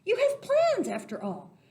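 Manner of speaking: high, loud huffy protest; indignant. Acted. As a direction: protest like an annoyed kid. Indignant big sibling.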